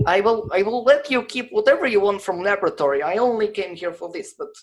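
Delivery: put-on Russian accent. GM voices Gregor.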